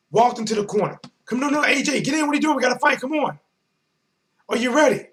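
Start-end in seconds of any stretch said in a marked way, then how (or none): 1.3-5.1 excited coaxing. Retold or imitated. Retold corner exchange.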